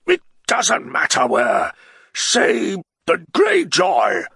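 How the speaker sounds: a tipsy nobleman begging to ride away; the Baron, pleading. Acted. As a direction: urge your friend to leave, loud and slow. Baron answers.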